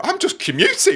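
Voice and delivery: high, loud comic protest; indignant protest. Retold or imitated. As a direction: plead your case loudly, hammed-up. Mock protest to the absent driver.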